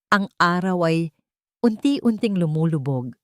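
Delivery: usual speaking voice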